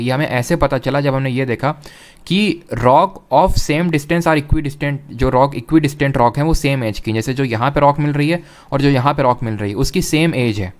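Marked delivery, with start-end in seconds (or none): 2.3-5.0 slow emphasis. Shown as a demonstration. Teacher stresses key fact.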